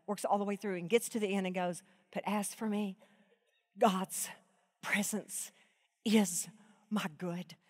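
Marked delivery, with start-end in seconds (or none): 2.1-7.6 much softer, word by word with pauses; tender hushed verse reading; hushed, halting. Shown as a demonstration. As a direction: breathe each word out softly.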